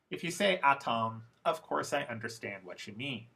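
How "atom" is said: "atom" with a crisp t and full "tom"; no schwa. Shown as a demonstration. Teacher quoting a less fluent learner, full-vowel "atom". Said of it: "if you put a schwa in there".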